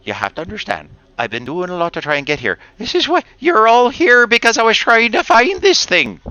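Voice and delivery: a lightly voiced dwarf trying to explain himself; intimidated dwarf pleading with the party. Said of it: "He's like".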